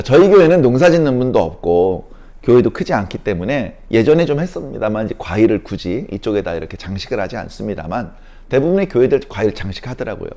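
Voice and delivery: normal talking voice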